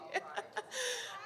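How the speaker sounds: high breathy laugh through tears; tearful laugh. Real, not acted. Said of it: "I've just been touched".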